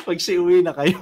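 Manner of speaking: laughing through the last words; laughing at punchline. Real, not acted. Speaker laughs at her own story's punchline to the audience. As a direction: crack up delivering the joke.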